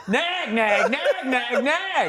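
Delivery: loud, high squawked nagging; exaggerated shrewish squawk; mock nagging. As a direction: nag on and on, way up high. Mocking a nagging spouse.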